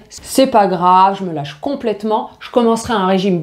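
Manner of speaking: «c'est pas grave» étiré, lent et nonchalant; voix intérieure imitée, lente. Retold or imitated. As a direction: imiter le lecteur qui se laisse aller, en traînant. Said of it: "ne vous dites jamais le week-end". (le lecteur qui se rassure le week-end, lentement et nonchalamment)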